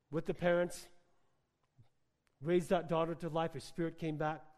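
normal talking voice

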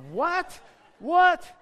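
high, loud, rising disbelief; shocked outburst. Retold or imitated. Reacting to the vet's price.

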